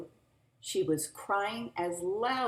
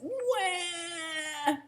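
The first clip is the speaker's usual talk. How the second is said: put-on baby cry; 13 semitones higher than usual. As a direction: wail like a crying baby. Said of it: "it says".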